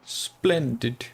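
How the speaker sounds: put-on British accent, drawn out; attempted British accent. Shown as a demonstration. Streamer showing chat their unreliable accent, attempted British accent. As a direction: try on a mock British accent. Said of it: "the funny thing about that accent".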